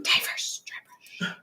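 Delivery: hushed whisper, no voice; whispered product name. Pretend. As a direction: drop into a playful stage whisper. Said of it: "Why is she whispering?".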